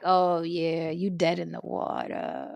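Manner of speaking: low and soft, dropping away; ominous verdict. Retold or imitated. Woman deciding the boyfriend is finished, low and ominous. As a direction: pronounce his doom quietly.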